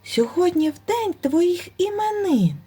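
high, timid and very polite hare voice; frightened hare pleading with the bear; most politely. A narrator's reading. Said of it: "найчемніше".

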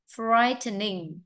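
usual speaking voice

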